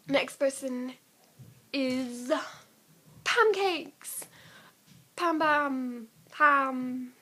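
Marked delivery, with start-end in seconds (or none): 3.2-7.1 excited calling. Real, not acted.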